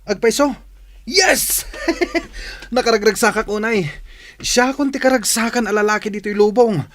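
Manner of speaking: high and loud, bursting with joy; can't contain his delight; overjoyed. Acted. Brando overjoyed she loves him.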